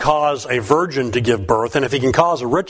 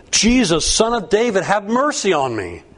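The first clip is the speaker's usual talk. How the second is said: cried out; 7 semitones higher than usual. A narrator's reading. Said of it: "And he cried out".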